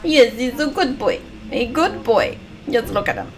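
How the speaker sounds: a tender American-free dog praise; cooing at dog. Real, not acted. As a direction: coo at the dog like a pet owner.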